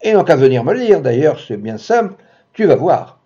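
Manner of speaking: snapping at her. Acted. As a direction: tell her off in a rage. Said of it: "Il l'envoya coucher". (rembarre-la, furieux, plus aigu et fort)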